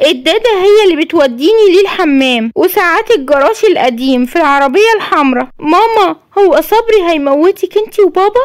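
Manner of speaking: put-on a frightened boy confiding in his mum; scared little boy. Yassin tells his mother he fears for her, childlike and timid.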